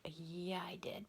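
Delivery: very soft, almost whispered reply; mock-innocent answer under the breath; hushed retold fib. Retelling her own sheepish false claim.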